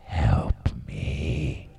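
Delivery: voiceless whisper for help; hushed, drawn-out comic plea; whispered mock plea. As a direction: whisper it like a secret cry for rescue. Mock plea for rescue from partner.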